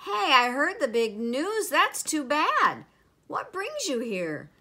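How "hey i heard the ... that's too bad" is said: loud, higher-pitched hearty sympathy; Neptune. A narrator's reading. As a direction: boom out the commiseration. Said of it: "Neptune boomed".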